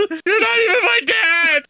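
put-on high whiny child voice; whiny kid. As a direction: play the resentful little boy. Mock quarrel, playing resentful son.

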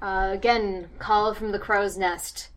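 ordinary talk

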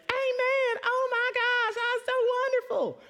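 very high, squealing praise; gushing church ladies. Retold or imitated. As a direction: coo like delighted churchgoers. Crowd voiced gushing.

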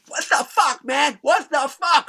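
high-pitched furious outburst, retold; pissed-off yelling. Kirk yelling at the band through the window, pissed-off. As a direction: imitate an angry homeowner yelling through glass. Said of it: "He's fucking pissed".

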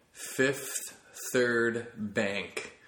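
very slow, savoring the name; drawn out. Acted. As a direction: stretch the bank name out.

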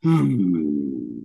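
low booming hum, satisfied; a deep pleased hum from a huge being; low, satisfied entity hum. Acted. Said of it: "a satisfied".

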